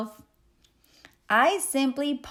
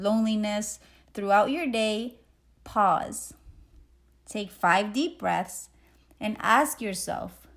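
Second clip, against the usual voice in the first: normal talking voice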